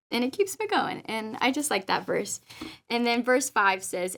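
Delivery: normal talking voice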